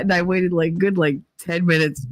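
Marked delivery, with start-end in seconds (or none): none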